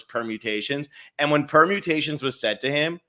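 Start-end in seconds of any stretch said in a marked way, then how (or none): none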